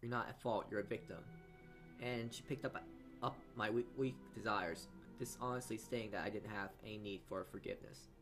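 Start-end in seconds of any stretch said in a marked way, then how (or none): none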